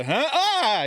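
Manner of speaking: sudden high startled cry; startled yelp. Retold or imitated. Friend mimicking a startled yelp at a sudden shock. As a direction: jump at a surprise in your hand.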